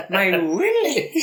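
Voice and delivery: laughing at the word willy. Real, not acted. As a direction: giggle out "willy". Repeating the funny word, laughing.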